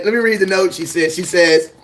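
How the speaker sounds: usual speaking voice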